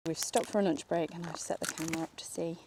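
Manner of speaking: quiet words sinking into a whisper; fading to a whisper. Real, not acted. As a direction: drop from soft talk to a whisper. Quiet near camera trap.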